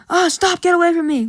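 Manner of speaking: high, loud panicked yelling; frightened outburst; scared cry. Retold or imitated. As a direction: fend off the birds, crying out. Gordon attacked by crows.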